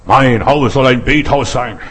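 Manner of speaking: loud, slow proclamation as Jesus; slow booming decree; commanding Jesus. Acted. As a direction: declare it like a command from the temple steps. Jesus quoted clearing temple.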